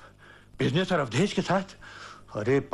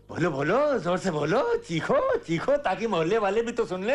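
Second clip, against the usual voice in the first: ordinary talk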